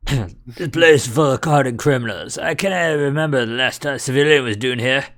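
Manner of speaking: put-on a light Scottish warden accent; lightly voiced Scottish burr. Governor greeting the visitors in a light Scottish accent. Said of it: "what kind of accent I gotta give him".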